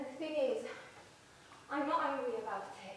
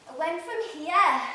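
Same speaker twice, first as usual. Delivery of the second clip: up high in the voice; high accent demo; 6 semitones higher, 12 dB louder, slower than usual. Shown as a demonstration. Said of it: "my accent".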